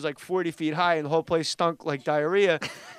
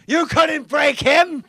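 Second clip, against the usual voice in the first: triumphant proclamation; 9 semitones higher, 9 dB louder, much slower than usual. Retold or imitated. Roaster proclaiming to the crowd.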